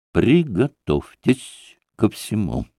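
slow, each syllable of «Приготовьтесь ко всему» struck separately; doctor rapping out «Приготовьтесь ко всему» syllable by syllable; clipped syllables. A narrator's reading. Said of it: "отчеканил, ударяя по каждому слогу".

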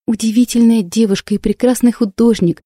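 ordinary delivery, nothing marked

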